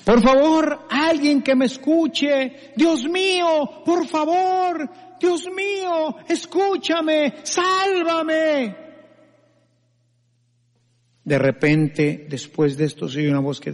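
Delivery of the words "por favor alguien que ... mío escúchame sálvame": high, slow desperate pleading; desperate plea. Acted. Climber clinging to a branch begs God to save him, high and desperate. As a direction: cry out to God, stretching each plea.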